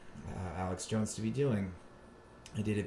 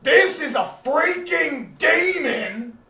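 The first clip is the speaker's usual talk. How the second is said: much higher, louder, slow shouted rant; Alex Jones rant; 14 dB louder, much slower than usual. Retold or imitated. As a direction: bellow like a raving radio host. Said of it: "just him being like".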